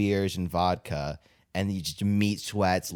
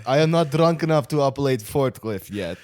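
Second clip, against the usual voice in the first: put-on Russian accent; 5 semitones higher, 3 dB louder than usual. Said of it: "Where this just Russian accent".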